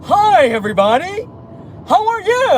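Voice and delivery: squeaky Alf greeting the stream. Pretend. Streamer doing her Alf voice for the chat. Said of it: "can I do the Alf, the Alf voice?".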